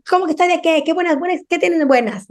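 mock the cranky child snapping back; grumpy kid retort. Daughter snapping at mom after school, mock grumpy retort.

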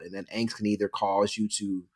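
ordinary delivery

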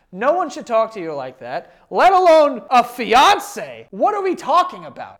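high, loud disbelief; indignant. Real, not acted. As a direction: exclaim it, appalled for someone else. Indignant on the writer's behalf.

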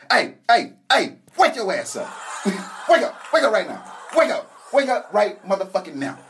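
loud, high yell to wake her; loud angry wake-up shout. Retold or imitated. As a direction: bark her awake, furious. Yelling at girlfriend to wake.

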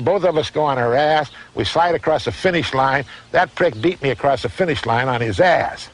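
ordinary talk

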